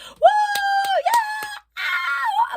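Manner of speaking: high whooping shouts; crowd cheering. Retold or imitated. Inmates cheering for the singer outside, shrill whoops. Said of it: "I hear mad people like".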